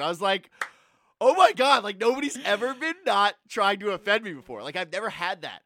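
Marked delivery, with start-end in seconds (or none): 1.2-4.6 excited. Retold or imitated. Retelling excited reaction.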